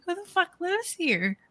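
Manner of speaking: high-pitched mock incredulity; retold disbelief. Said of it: "I'm like".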